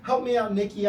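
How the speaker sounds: ordinary delivery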